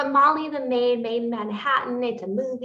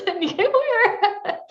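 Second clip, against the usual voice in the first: high giggling reply; laughing; 14 semitones higher, much slower than usual. Real, not acted. Laughing back at her co-host who admits it. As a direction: giggle the words out.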